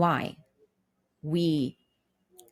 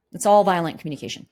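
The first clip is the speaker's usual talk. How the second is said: ordinary delivery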